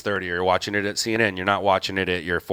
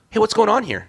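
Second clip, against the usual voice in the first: much higher and louder, a sudden nosy question; mock nosy bystander; 12 semitones higher, 5 dB louder than usual. Veteran cameraman imitating the bystander who talks the moment you hit record, much higher. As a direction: jump in like the guy who wakes up when you record.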